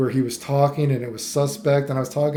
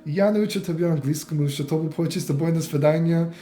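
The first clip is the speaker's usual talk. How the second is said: higher-pitched mock attempt at Russian small talk; pretend pleading in Russian; 5 semitones higher than usual. Vlogger imagining talking himself out of trouble in Russia, mock pleading. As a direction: throw out random Russian phrases, pitched up. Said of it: "What Russian do I say to get myself out of trouble".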